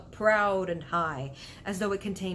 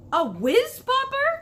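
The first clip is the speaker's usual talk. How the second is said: very high, loud, slow gleeful exclamation; delighted cry; 9 dB louder than usual. Acted. BFG beaming at Sophie, very high loud delighted cry. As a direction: squeal it with beaming joy. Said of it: "cried the B F G, beaming".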